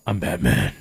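Batman voice. Retold or imitated. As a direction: growl it like a dark hero. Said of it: "that kind of voice".

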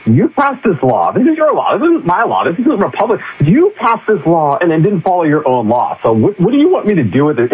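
an exasperated voice spelling it out; exasperated court. Retold or imitated. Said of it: "the court literally said".

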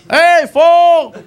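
high-pitched, drawn-out holler; heckler from the back seat. Retold or imitated. Friend heckling a cop from the back seat, high mocking holler. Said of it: "All I hear from the front seat is this".

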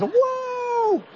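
very high, excited whoop; crew's whoa. Retold or imitated. Crew cheering as the distance number drops. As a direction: let out a delighted whoa. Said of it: "And everybody goes".

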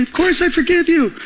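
high, insistent retold reassurance; acted, high-pitched forgiveness; emphatic reassurance. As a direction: insist on it as if obvious. Reassuring a doubting apologizer, retold.

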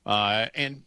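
ordinary talk, nothing marked

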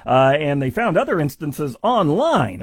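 ordinary talk, nothing marked

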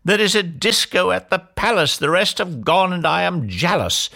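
high-pitched, insistent tantrum; demanding shout. A narrator's reading. Cinderella demands the ball from the fairy, shouting. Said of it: "beat her fist against the wall and shouted".

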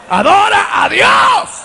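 high, slow, shouted call; shouted climax. Real, not acted. Preacher urging the church to worship God, high shout. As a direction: peak of the repeated call. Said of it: "repeated, building to a shout".

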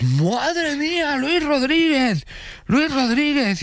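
very high, drawn-out excited cry; mock excited cheer. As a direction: gush over the famous chef. Imitating excited contestants.

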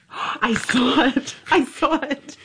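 high-pitched, thrilled discovery; high, wonderstruck delight; real excitement. Real, not acted. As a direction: exclaim it high, amazed at the sight. Thrilled at seeing the spark.

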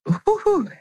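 high squealed whoo-hoo; playful high whoo-hoo of disbelief; mock whoo-hoo. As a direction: let out a joking high whoop. Joking about pregnancy hormones.

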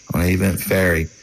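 usual speaking voice

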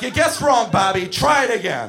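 loud, high, fed-up scolding; scolding a kid for the wrong skate size; exasperated shout. Retold or imitated. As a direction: bark it at a clueless kid. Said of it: "I'm like".

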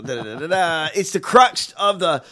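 normal talking voice

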